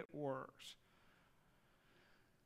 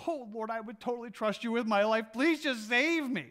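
higher, louder pleading cry to God; man dangling from a root, pleading loudly; desperate prayer; 5 semitones higher, 6 dB louder than usual. Acted. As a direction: beg for rescue while hanging off a cliff. Man begging God in fable.

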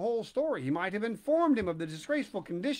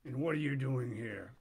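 polecat questioning the puppet; low polecat voice; 6 semitones lower, 4 dB quieter than usual. Acted.

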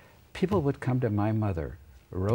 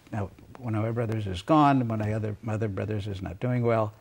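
ordinary delivery

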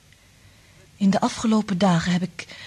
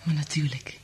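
soft intimate reply; 4 semitones lower, 7 dB quieter than usual. Acted. Intimate love scene, soft reply.

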